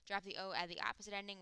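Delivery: normal talking voice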